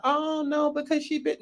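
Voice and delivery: high-pitched mocking whine of excuse; mock excuse-making. Fans excusing the cast member, high mock protest. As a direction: make fun of her defenders in a high voice.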